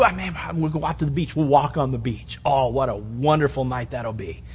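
soft, low hopeful musing; dreamy planning suitor. Retold or imitated. Preacher voicing a young man dreaming of the date, softly. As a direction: daydream about the beach date. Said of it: "what you were gonna do".

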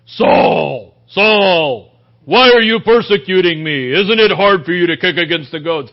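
loud booming movie voice from heaven; dramatic Hollywood voice. Shown as a demonstration. Preacher hamming up heaven calling Saul, loud and dramatic. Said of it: "It's a little bit more dramatic".

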